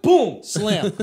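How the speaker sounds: ordinary talk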